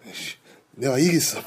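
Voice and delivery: Choi Min-sik voice. Retold or imitated. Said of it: "최민식".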